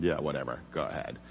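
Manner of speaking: tired cop waving off a request to smoke; dismissive. Retold or imitated. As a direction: brush it off wearily.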